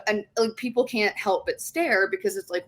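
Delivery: ordinary delivery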